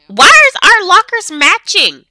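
mock outrage. Outraged at matching lockers.

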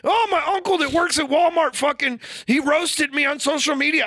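very high mock-wailing complaint; hammed-up imitation of someone moaning; mocking whine. Imitating people upset by their uncle, mocking high wail.

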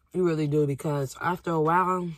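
talking with mouth partly full; eating while speaking; chewing fries. Real, not acted. Seen 1: bites fry at 6.4, then seems to chew while still talking.

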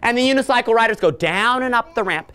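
ordinary talk, nothing marked

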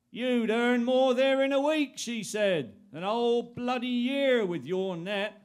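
nagging wife voice. Retold or imitated. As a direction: press him like a nagging wife. Missus voiced in story.

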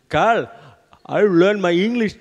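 loud, high attempt at a Spanish accent; botched Spanish accent that comes out Indian; bad Spanish accent. Spanish bloke approaching the comic, loud bad Spanish accent. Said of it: "can't do Spanish accent".

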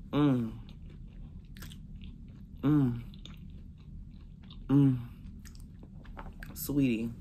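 chewing while humming; muffled closed-mouth murmur; mouth full. Real, not acted. Seen 1: Lips closed, jaw working; picks chicken apart right after biting it.

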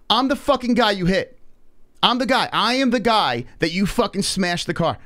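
high-pitched, indignant retold outburst; angry confrontation. Crash victim confronting the driver at her window in the retelling, angry.

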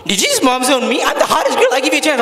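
indignant date. Acted. Date accusing him of mom-zoning her, mock indignation.

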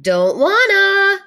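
high, loud, slow whine; loud whiny protest. Acted. Lardy refusing to stay clean, loud whine. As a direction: drag it out in protest.

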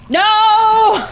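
high, loud cry of dismay; dismayed shout. Real, not acted. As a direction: yell as it all goes wrong. Broom breaks, dismay.

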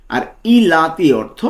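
ordinary talk, nothing marked

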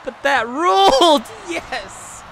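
high, loud, slow cheer; thrilled cheer. Real, not acted. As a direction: stretch it out in pure delight. Cheering a great spot.

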